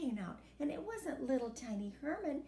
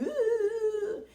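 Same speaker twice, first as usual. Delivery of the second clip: loud high mocking ooh; high loud taunting ooh; 9 semitones higher, 8 dB louder than usual. Siblings' noise imitated.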